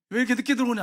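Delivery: high, loud telling-off; angry scolding. Retold or imitated. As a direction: act out an angry father demanding an answer. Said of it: "야단을 쳤어요".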